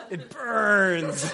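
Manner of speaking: drawn-out "burns" into a laugh; mock agony, laughing. Lecturer joking about Aragorn's big guns, mock agony. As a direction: play stung, then crack up. Jokes about the retort's sting.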